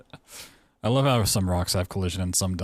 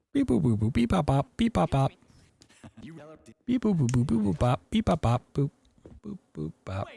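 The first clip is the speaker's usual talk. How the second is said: higher beeping robot chatter; 9 semitones higher than usual. Pretend. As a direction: rattle off beeps like a robot. Makes computer beeping noises.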